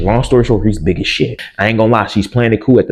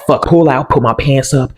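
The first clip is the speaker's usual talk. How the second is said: usual speaking voice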